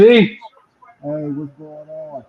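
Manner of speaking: muffled, like through a wall. Real, not acted. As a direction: voice muffled and distant. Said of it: "You sound muffled".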